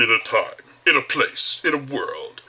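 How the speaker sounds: lightly voiced trailer announcer; measured trailer-voice openings, phrase by phrase; Don, movie trailer voice. Imitating the movie guy's opening phrases, trailer voice. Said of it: "always starts off with one of those three phrases".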